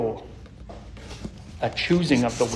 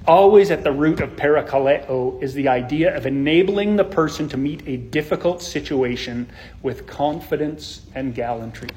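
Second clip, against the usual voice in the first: ordinary delivery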